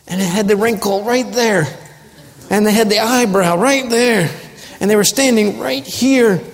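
hammed-up wounded recollection, climbing in pitch; obsessive grudge. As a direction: act out nursing a grudge, dwelling on each detail. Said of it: "Remembering others' offenses against you with great specificity".

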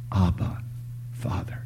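soft appeal fading to a whisper; hushed cry. Acted. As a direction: quietly call on God, almost whispering. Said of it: "we know to cry out".

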